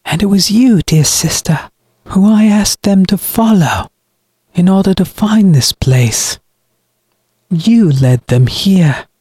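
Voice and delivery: high-pitched villain, cutting words; cold, accusing brother. Acted. Brother blames his sister for the deaths, accusing. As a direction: place the blame on her, slowing at the end.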